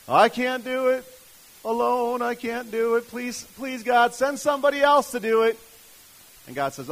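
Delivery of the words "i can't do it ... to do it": high, loud mock whine; hammed-up helpless begging, pitched way up; whining plea. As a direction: beg like someone desperate to get out of it. Acts out Moses pleading helplessly.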